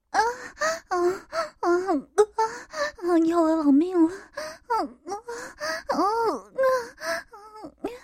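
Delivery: high-pitched, acted cries; long moaning outcry. A narrator's reading. Mother crying out to the narrator, moaning high. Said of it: "柳青直接一声长吼".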